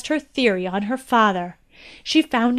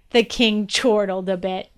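plain reading voice

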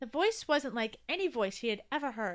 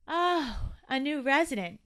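raised, relieved realization; 5 semitones higher, 6 dB louder than usual. A narrator's reading. Toby learning who the voice is, relieved. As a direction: let the worry drop out of the voice.

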